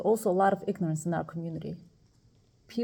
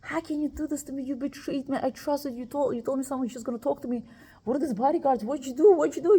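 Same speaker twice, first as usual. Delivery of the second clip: imitating a screaming rant; 6 semitones higher than usual. Patient turns on her friend in the ward, hammed-up angry yelling. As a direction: voice the patient's furious accusations, building. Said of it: "screaming, yelling, cursing the life out of me".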